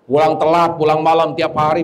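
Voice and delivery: ordinary talk, nothing marked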